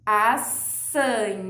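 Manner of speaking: "assanhada" very slowly, syllable by syllable; teacher stretches "assanhada" for kids; syllables drawn out. Shown as a demonstration. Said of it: "A mesma coisa no". ("assanhada" bem devagar, separando as sílabas)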